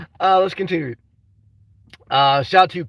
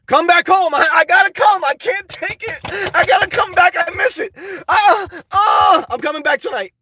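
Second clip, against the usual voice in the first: mock wailing; 7 semitones higher than usual. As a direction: wail like a homesick kid, over the top. Whines about going home to Florida.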